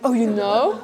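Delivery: "Oh, you know?" tipping into a breathy laugh; relieved laugh at being found out; laughing surprise. Real, not acted. Surprised stepbrother knows too.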